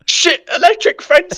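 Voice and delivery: a hint of real panic, drawn out; mock panic. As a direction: warn of the fence in a retold fright. Panicked warning about the fence.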